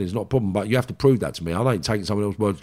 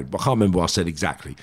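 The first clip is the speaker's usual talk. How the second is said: ordinary talk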